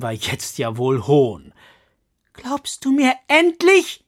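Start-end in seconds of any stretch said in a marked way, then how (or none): 2.3-4.0 loud, high, insistent question. Acted. Katrina loudly pressing the old woman. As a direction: call it out, demanding to be believed. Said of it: "sagte sie laut".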